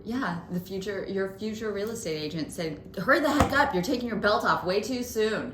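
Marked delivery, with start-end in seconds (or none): 3.0-5.5 mock bossy. Mock-scolding Bradley as agent.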